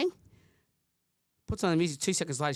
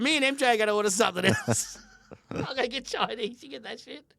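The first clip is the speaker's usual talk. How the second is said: chuckling as he speaks; laughing through words; 8 semitones higher, 3 dB louder than usual. Real, not acted. Dad laughing about the takeaway order, chuckling.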